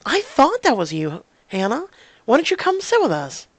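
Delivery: lively high-pitched friendly invitation; friendly classmate. Acted. Voices Caitlin, game friend.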